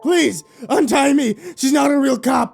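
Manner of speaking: panicked pleading shouts; shouting. Acted. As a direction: shout to keep them away. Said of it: "yelled Demonold".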